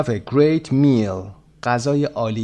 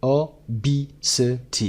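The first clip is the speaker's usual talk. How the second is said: "O, B, C, T" as four separated beats of obesity; syllable by syllable. Shown as a demonstration. Teacher sounding out obesity for learners, four separate beats. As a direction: break it up evenly, "O, B, C, T". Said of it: "استرس روی قسمت B".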